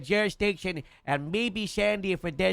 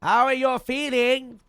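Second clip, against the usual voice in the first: loud; 7 semitones higher, 4 dB louder, slower than usual. Real, not acted. Speaking to a half-deaf patient.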